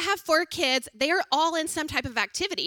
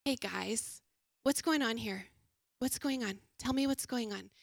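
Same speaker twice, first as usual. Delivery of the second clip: put-on soft, lowered, patient questions to the kids; gentle calm voice; 2 semitones lower, 6 dB quieter than usual. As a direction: ease them down gently. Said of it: "And instead I say".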